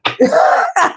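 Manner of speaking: a high, breaking burst of laughter; high cackling laugh. Real, not acted. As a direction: crack up laughing. Laughs at son crying story.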